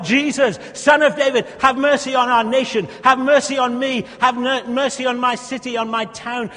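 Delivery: loud, high fervent cry. Acted. Preacher crying out to Jesus for the nation, loud. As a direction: plead for the nation.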